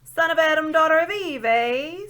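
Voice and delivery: put-on a deaf old dwarf shouting his grievance; shouting old dwarf who can't hear; loud old dwarf. A narrator's reading.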